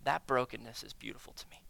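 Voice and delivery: whispered softly, as God's tender voice; gentle whisper. Retold or imitated. God tenderly reassuring the broken believer, in a whisper. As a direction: hush it tenderly. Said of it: "And he goes".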